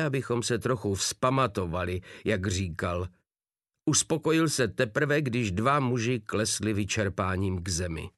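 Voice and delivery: ordinary delivery, nothing marked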